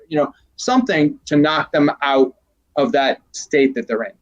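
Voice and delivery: ordinary talk, nothing marked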